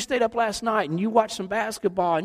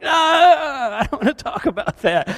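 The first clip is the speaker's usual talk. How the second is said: loud, laughing groan of refusal; exasperated groan; 7 semitones higher, 4 dB louder than usual. Retold or imitated. Pastor brushing off theology debate, laughing groan. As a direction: wave it off with a big laughing groan. His own reaction to debate.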